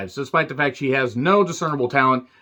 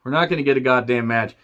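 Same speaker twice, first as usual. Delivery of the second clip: bored crowd; 7 semitones higher than usual. Retold or imitated. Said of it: "they were like".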